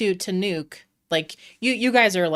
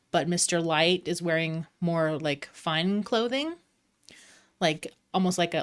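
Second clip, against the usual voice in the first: normal talking voice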